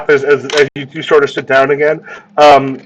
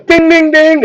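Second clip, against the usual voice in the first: bell ding; 13 semitones higher, 3 dB louder, slower than usual. Pretend. Bell sound imitated, high.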